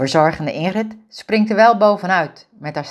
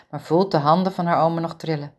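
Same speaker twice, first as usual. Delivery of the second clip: usual speaking voice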